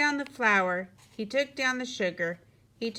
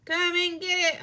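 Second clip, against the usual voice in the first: high, raised calling voice; hollering; 9 semitones higher, 4 dB louder than usual. A narrator's reading. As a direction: call everyone in to eat. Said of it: "hollered Froggy".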